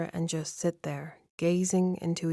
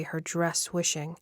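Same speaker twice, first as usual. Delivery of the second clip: ordinary delivery, nothing marked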